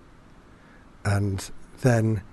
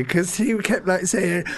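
normal talking voice

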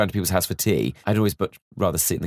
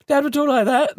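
much higher and a little louder, a mock voice for dad; dad's voice; 4 dB louder than usual. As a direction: pitch the dad up high as a send-up. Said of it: "a bit kind of".

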